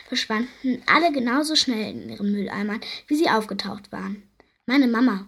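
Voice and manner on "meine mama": ordinary delivery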